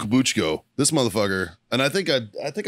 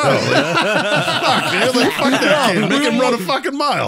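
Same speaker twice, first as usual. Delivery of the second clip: loud, very high-pitched mock rant; laughing mock outburst; 13 semitones higher, 6 dB louder, slower than usual. Sergeant on making the kid run, laughing mock outburst. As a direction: shout it through laughter, hamming it up.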